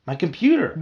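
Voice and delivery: high, loud thrilled exclamation; excited cry. Acted. Bee spotting the computer, excited. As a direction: burst out as the idea hits. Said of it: "I cried excitedly".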